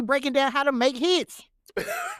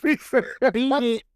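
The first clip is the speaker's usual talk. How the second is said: deadpan lyric reading; 5 semitones lower than usual. Pretend. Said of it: "But that ain't how you said it".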